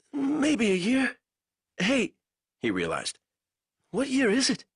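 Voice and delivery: a weak captive fumbling for words; lightly voiced delirious prisoner; feverish prisoner. A narrator's reading. Banks voice, slow.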